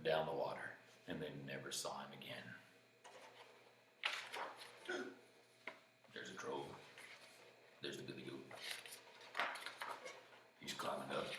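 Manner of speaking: very soft, low murmur; hushed narration. A narrator's reading. Storyteller quietly pointing out troll and goat, hushed. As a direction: drop right down, low and quiet.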